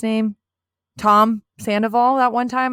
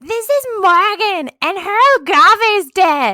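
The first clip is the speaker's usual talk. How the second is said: high, loud, drawn-out mock introduction; gushing introducer; 11 semitones higher, 9 dB louder than usual. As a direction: announce it to the whole bar, hammed-up.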